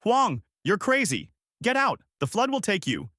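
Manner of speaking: high-pitched urgent warning; mild bellow. A narrator's reading. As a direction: cry out in alarm, pitch well up.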